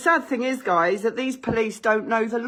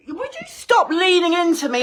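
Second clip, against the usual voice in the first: irritated; 5 semitones higher, 4 dB louder than usual. Real, not acted. Confronting officer in her hallway.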